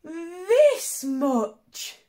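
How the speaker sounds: high and loud, arms flung wide; little hare voice. Acted. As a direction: shout it out high like a proud little bunny. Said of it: "said little nut brown hare".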